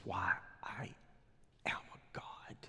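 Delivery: king whispering his own divinity; hushed proud claim. Acted. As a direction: breathe it like a sacred secret. Proud king declares himself god.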